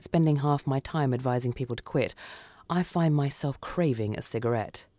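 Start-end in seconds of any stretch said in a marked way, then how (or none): none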